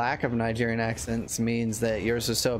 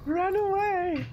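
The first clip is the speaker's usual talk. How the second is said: high, slow, hammed-up cry to flee; panicked cry; slower than usual. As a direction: shriek it like a cartoon coward. Acting out a panicked retreat.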